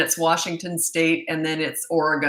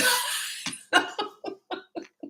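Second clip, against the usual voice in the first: long, high breathy laugh; amused laugh; 13 semitones higher, 4 dB quieter than usual. Real, not acted. Host finding the wrong thing on homepage, laughing at herself. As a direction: laugh helplessly at your own slip.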